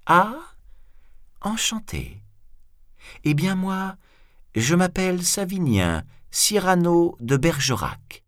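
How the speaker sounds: high-pitched, airy politeness; playful, unbothered formality; mock introduction. A narrator's reading. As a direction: introduce yourself with feigned courtesy. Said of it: "comme si l'autre venait de lui donner son nom".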